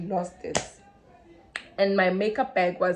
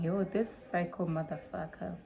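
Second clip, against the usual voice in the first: much softer muttered private thought; inner thought; 3 semitones lower, 11 dB quieter than usual. Retold or imitated. Narrator's unspoken thought about Natasha, softly muttered. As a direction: mutter it to yourself, not out loud. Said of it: "I was not saying it out loud".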